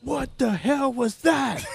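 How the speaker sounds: high, slow angry yell at a teammate. Retold or imitated. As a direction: bellow it out, high and drawn out. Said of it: "and he s-, he yells".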